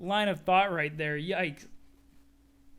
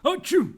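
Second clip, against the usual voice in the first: fake sneeze; 9 semitones higher, 12 dB louder than usual. Mock sneeze.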